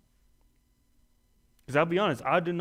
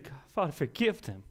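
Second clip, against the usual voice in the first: soft, hushed prayer of forgiveness; gentle plea; 2 semitones higher, 5 dB quieter than usual. Acted. Preacher voicing Jesus forgiving from the cross, soft and tender. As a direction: let it fall quietly, full of mercy. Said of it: "Instead, he said".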